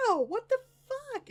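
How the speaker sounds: high yelp of pain, retold; mock pained yelp. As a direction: yelp like the burn just hit. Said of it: "and I'm like".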